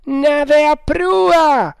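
very high, slow, drawn-out hail; sudden shout. A narrator's reading. Lookout sighting a ship ahead, shouting. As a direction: stretch it into a lookout's cry. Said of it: "gridò a un tratto il gabbiere".

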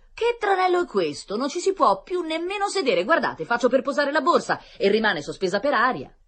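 high, loud outraged lady; grido indignato. A narrator's reading. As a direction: cry out in shrill-pitched indignation at everyone around. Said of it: "La signora gridò". (grido acuto e indignato)

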